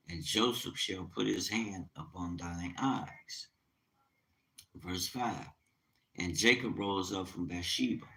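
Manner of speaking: quiet solemn scripture voice; scripture reading. Acted. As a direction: read the verse with reverence. Reads scripture aloud.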